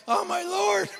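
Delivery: high, drawn-out exclamation; mock astonishment. Speaker reacting to the company's count, mock astonished. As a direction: wail it in comic disbelief.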